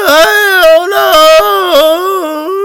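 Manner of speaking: high, very slow, loud crying shout; imitated bawling. Brother answering mom, loud high sobbing wail. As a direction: wail it helplessly through sobs. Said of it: "just him bawling on the phone".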